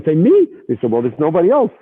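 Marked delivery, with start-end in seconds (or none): 0.1-0.5 high, astonished me; higher surprised question. Retold or imitated. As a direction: react startled. Surprised at being chosen.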